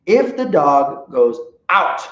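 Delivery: ordinary talk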